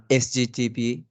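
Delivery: normal talking voice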